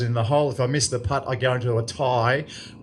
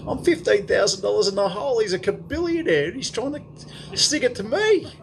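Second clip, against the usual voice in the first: outraged; 10 semitones higher than usual. Real, not acted. Outraged realisation about rival.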